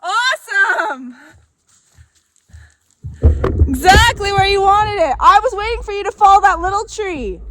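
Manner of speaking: high, loud call across the yard. Real, not acted. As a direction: shout it over the distance. Calling across after tree falls.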